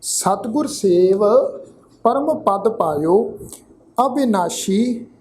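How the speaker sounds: slow, drawn-out sacred reading; scripture recitation. Shown as a demonstration. Teacher models the scripture reading for the congregation, slow recitation. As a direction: recite it slowly, holding the long vowels.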